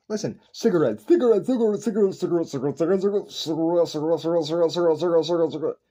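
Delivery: rapid chant of cigarette, cigarette, cigarette; repeated until meaningless. Shown as a demonstration. Proving a word is just noise, rapid repetition. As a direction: repeat cigarette fast until it turns to mush. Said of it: "It's just a noise".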